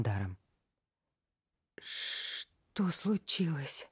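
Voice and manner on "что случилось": barely voiced, slow and soft; just mouth the words. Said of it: "Одними губами произнесла она".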